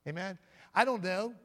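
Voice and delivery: ordinary talk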